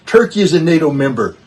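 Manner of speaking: loud, a step below the previous line; firm emphasis. Real, not acted. Emphatic parallel point on NATO disunity.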